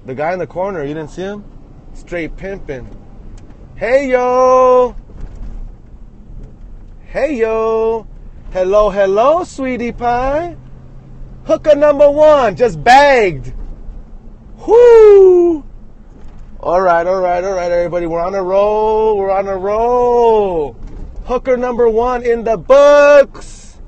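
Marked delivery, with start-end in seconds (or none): 3.8-15.6 high, loud hollering call; loud celebratory catcall yelling. Real, not acted. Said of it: "if I yell loud enough". 16.6-23.8 hyped, high victory call; celebrating. Real, not acted. Celebrating loudly after his catcalls.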